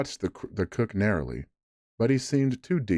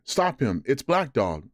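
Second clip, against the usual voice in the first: alarmed shout; 6 semitones higher than usual. Acted. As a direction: shout to stop the fleeing man. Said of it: "I cried".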